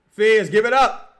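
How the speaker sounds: 훨씬 높고 크게 외침; shouting at the screen. Real, not acted. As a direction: yell the instruction at the player. Urging player during game.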